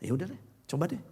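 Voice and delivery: soft, grudging. Acted. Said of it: "the whisper of hope".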